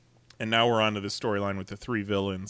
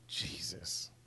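whispered exasperation; 18 dB quieter than usual. Real, not acted. Exasperated aside after rant.

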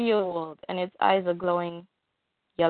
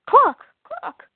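high, loud desperate calling of a name; hammed-up shout for her husband; frantic call; 7 dB louder than usual. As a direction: cry out for him in panic. Lois calls out to Clark.

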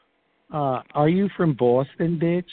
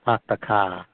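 Boston accent with R-less "Park the car". Shown as a demonstration. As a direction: demonstrate how Bostonians talk. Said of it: "Yeah, they talk that way".

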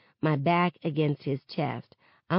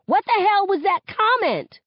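angry confrontation; 5 dB louder than usual. A narrator's reading. Star confronting friends angrily.

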